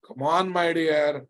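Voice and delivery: ordinary talk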